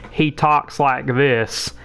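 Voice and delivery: slow Texas country drawl; country accent. Retold or imitated. Imitating a Texan schoolmate in a slow country accent. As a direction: mimic a slow country boy's Texas speech. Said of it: "I can mimic his voice perfectly".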